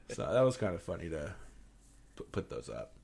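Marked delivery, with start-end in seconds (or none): none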